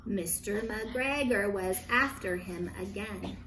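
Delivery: ordinary delivery, nothing marked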